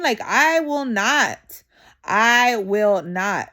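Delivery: each word slow and weighted; slow firm refusal. Real, not acted. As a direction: lay down the law slowly. Refusing to bend to anyone.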